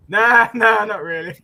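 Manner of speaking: imitating a cheerful, wounded denial; happy but upset. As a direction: mimic him, loud and high at first. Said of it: "happy, but also really upset that this".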